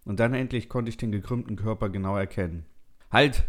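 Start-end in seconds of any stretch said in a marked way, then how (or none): 3.1-3.4 abrupt, louder and higher order; sharp command. A narrator's reading. Narrator ordering the car to stop, sharp command. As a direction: call out a sudden halt. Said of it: "befahl ich dem Auto".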